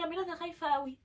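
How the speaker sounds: retold frightened crying. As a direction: imitate a scared sister pleading. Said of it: "وقعدت ترعش وقعدت تعيط".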